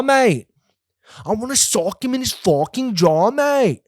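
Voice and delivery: high, camp mock British voice with "mate" tossed up; mock British accent. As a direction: ham up a London lad.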